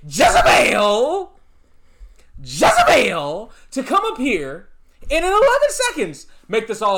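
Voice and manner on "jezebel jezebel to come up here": slow, louder, drawn-out wrestling-promo Jezebel; Dusty Rhodes Jezebel. Acted. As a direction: call her Jezebel like a ring announcer-style wrestler. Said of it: "as Dusty Rhodes would say".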